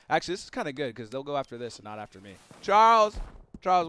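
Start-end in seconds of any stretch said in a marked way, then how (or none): 2.6-3.9 calling out. Real, not acted. Calling his horse companion.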